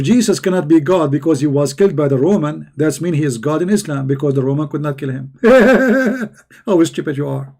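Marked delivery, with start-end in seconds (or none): 5.4-6.3 mocking laugh. Real, not acted. Mocking the opponent's logic.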